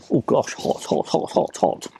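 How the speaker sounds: panting through hot food; burning mouthful, breathy gasps; mouth full of hot food. Real, not acted.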